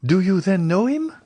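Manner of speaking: put-on high, hopeful young woman's cry; eager cry. A narrator's reading. Young Julie to the Count, crying out in eager hope. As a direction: burst out in sudden hope. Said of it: "cried Julie".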